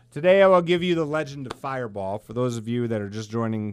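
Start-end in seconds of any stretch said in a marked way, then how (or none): 0.1-2.2 louder, mock-grand announcement of the legend; storyteller intro. Real, not acted. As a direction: present the tale like a showman.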